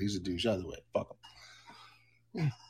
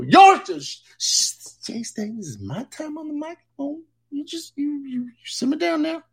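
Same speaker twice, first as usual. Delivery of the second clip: much higher, loud shushing scold; sassy Jessica shushing; 10 semitones higher, 5 dB louder than usual. Acted. Jessica shushes Jay Stan off the microphone, high and sassy. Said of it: "Here's Jessica".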